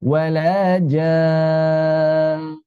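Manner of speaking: drawn-out, chanted verse recitation; slow Quran recitation. Shown as a demonstration. Teacher reciting verses as a model for students. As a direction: chant each word slowly and evenly. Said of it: "recitation demo".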